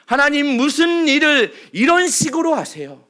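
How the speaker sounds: loud, exasperated cry at God; loud complaint. Acted. Moses complains to God, loud and exasperated. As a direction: throw the question up at heaven. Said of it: "하나님을 향한 원망과 불평".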